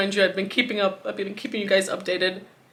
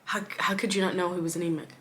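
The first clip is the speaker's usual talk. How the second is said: normal talking voice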